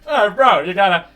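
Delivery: frat bro. Acted. McCucklin brothers voiced by GM.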